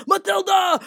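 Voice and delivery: shouted high, a mean father yelling; angry dad yell. Retold or imitated. Retelling Matilda's dad yelling at her, shouted. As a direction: bark the name like a furious dad. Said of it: "the dad was like".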